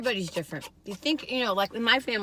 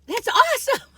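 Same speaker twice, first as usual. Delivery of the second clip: much higher, loud squeal of delight; squealed praise; 9 dB louder than usual. Retold or imitated. Praising aged aunt's swimming again, squealing higher. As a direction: top the first cheer with a squeal. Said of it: "I was like".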